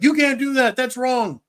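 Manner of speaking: high, loud sneering scold; mocking scold. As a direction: mimic a self-righteous critic. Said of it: "and they're like".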